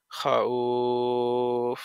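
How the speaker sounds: খাউফ very slow and low, glide held long before f; madd lin stretch. Shown as a demonstration. Teacher demonstrating madd lin, low glide held very long. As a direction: hold "khau" on one tone, then close খাউফ. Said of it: "মাদে লিন".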